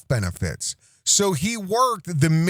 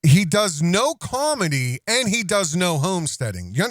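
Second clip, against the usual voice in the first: ordinary talk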